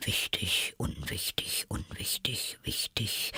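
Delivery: soft whisper, trying out each word; half-voiced whisper. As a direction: whisper the words as if testing their sound. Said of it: "mit halblauter Stimme".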